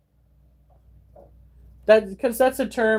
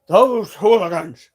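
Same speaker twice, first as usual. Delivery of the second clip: mock scandalised; 6 dB louder than usual. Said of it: "I think to myself".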